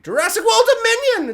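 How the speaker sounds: very high, loud title cry; mock announcement. Reviewer wrapping up, title announced in a high shout. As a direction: belt out the film title.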